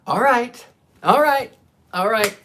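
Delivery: high, loud, repeated giving-in; exasperated surrender. Retold or imitated. Dreamer giving in to the puppet, loudly exasperated.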